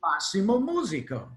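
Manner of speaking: high, lightly voiced Italian accent. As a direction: proudly announce yourself like the Italian pipe maker. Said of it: "He had a very thick Italian accent".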